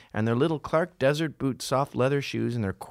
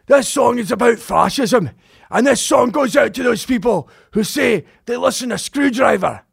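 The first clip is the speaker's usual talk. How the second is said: loud, high-pitched Scottish shout; Scottish punk singer; 11 semitones higher, 7 dB louder than usual. Retold or imitated. Singer dedicating a song from the stage, shouted Scottish accent. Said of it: "they're Scottish".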